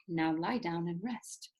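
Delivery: slow whispered instruction; calm the patient in a whisper; doctor whispers slowly to settle him; soothing whisper. Acted.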